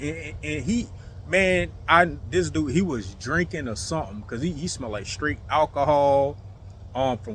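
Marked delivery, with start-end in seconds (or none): none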